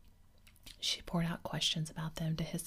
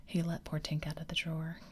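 plain reading voice

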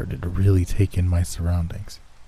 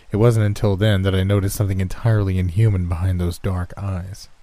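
ordinary delivery

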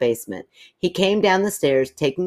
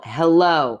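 a slightly raised hello; loud hello; 4 dB louder than usual. A narrator's reading. Cat surprising the narrator from behind, mildly loud. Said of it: "a loud".

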